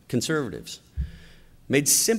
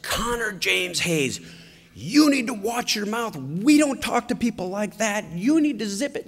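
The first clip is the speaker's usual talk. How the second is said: higher-pitched stern scolding, the name said slow; mock mother scolding; 9 semitones higher than usual. Wife scolding her son in the car, higher stern voice. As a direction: read him the riot act like a fed-up mom.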